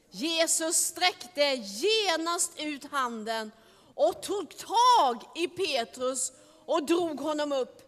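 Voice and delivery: plain reading voice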